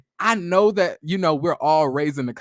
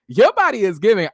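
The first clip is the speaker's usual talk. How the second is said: Your body is giving, higher and louder; hyped-up compliment; 5 semitones higher, 8 dB louder than usual. Real, not acted. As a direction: gush it out with excitement. Repeats compliment, then hypes it.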